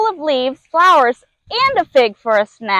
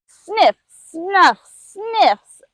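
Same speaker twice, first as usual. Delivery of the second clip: sniffing; 6 semitones higher, much slower than usual. Acted. Lemur sniffing the air.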